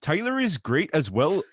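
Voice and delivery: put-on Canadian accent. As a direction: read it like a jokey Canadian fan. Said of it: "I should be reading this in a fucking Canadian accent".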